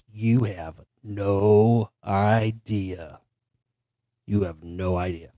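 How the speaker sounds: low, slow, hushed and ominous. Retold or imitated. She whipped around, ominous reply.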